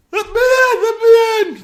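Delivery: screamed very high and loud, frantic; panicked pounding. Retold or imitated. Peter bangs on the door begging to be let in, panicked scream. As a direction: yell like someone terrified outside the door. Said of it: "it was like".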